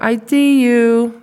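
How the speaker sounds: I-D-U very slow and high, three stretched syllables; slow babbled tripling. Shown as a demonstration. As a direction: draw out I-D-U high and slow for a toddler. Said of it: "or I don't know".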